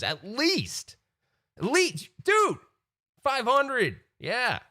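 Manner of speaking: mock-excited hype, voice cracking high. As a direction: sell it like a huge deal, pitch climbing. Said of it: "I'm like".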